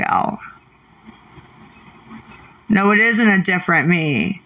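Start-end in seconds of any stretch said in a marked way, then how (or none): none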